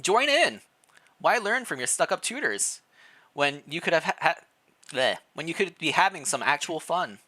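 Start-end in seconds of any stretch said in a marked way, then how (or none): none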